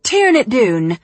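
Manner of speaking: annoyed Irish housemate yelling about loud music; thick Irish accent. Acted. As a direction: shout it down the stairs in an Irish brogue. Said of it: "said in her thick Irish accent".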